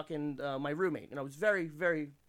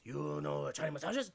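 a hint of a Chinese masseuse; Chinese accent. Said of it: "the Chinese lady alluded to it with".

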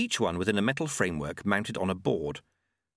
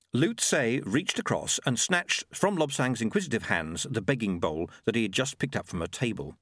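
plain reading voice

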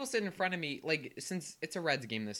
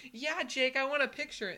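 high-pitched, excited call back; eager fan; 10 semitones higher, 4 dB louder than usual. Retold or imitated. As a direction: answer the player like a thrilled fan. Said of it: "And he's like".

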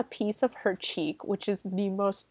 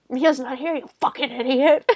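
higher, sneering demon voice; demon taunt; 5 semitones higher, 5 dB louder than usual. Acted. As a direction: spit it at him like the demon. Possessed Mia taunting her brother.

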